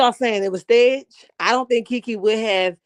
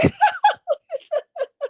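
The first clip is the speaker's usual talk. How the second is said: high-pitched cackle of real laughter; helpless squealing laugh; high shrieking laugh. Real, not acted. Bursts out laughing.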